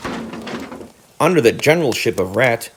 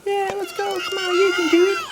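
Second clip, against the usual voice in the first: high little mouse voices cheering a shy friend. A narrator's reading. Field mice urging their shy comrade on, high cheering. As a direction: egg him on to recite. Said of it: "His comrades cheered him on".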